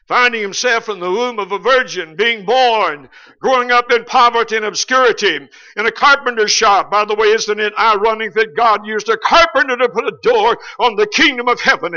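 loud, rising preacher's climb; preaching at full force. Acted. Preacher rousing the congregation, loud and rising. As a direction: build the sermon to a big loud peak.

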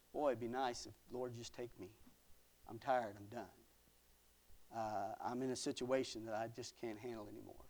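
low, soft, worn-out plea to God; weary despair. Acted. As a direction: give up quietly, as if done. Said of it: "Talk about despair".

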